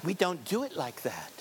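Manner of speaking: soft, cooing mild correction; mock gentle parenting. Today's parent correcting a child, mock-gentle and soft. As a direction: send up a sugary, softly spoken telling-off. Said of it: "That's how they talk today".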